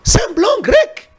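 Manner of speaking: mocking. Mocking the rival as foreign.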